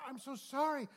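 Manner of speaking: high, loud burst of remorse; imitated gushing apology; mock frantic apology. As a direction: act out being mortified and sorry. Acting out the woman's frantic apology.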